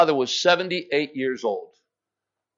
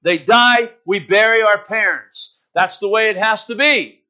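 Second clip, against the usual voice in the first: raised, ringing pulpit voice; preaching; 5 semitones higher, 6 dB louder than usual. Acted. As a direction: preach it out to the congregation. Preacher retelling Lazarus story.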